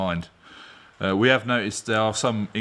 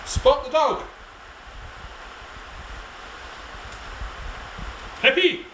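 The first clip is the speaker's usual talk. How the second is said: very high pet-calling voice; calling the dog; 3 dB quieter than usual. Real, not acted. Owner calling his dog Peppy, high pet voice. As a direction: call out to the dog, high-pitched.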